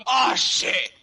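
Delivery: loud, high angry "Oh, shit!". Pretend. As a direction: spit it out in anger. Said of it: "say it angrily".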